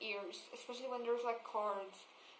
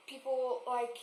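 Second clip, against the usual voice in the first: ordinary talk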